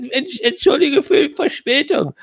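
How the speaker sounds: high, sheepish wizard; harried wizard voice. Acted. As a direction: apologize as a worn-out wizard. Clock voiced by GM.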